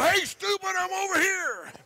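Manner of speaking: high, shouting like a taunting buck; mock deer shout. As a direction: yell it out to lure the deer.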